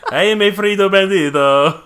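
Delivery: Spanish accent. Shown as a demonstration. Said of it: "say it in a Spanish accent".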